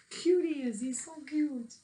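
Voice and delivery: high cooing over a cute picture; cooing aside. Real, not acted. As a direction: coo at the picture.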